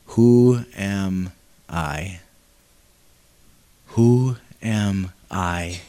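low, very slow, hesitant; doubting Moses. Acted. Moses answering God in doubt, low and slow. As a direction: shrink into the question.

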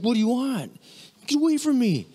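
high, mock-alarmed recoil; suspicious foreign visitor. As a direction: back away as if the kindness were a trap. Visitors freaked out by kindness.

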